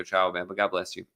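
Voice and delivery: ordinary talk, nothing marked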